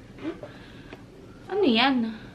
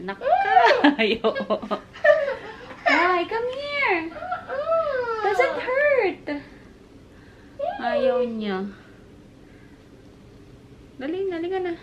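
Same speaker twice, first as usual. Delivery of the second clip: high sing-song coaxing voice; 12 semitones higher, slower than usual. Real, not acted. Coaxing toddler to get earring.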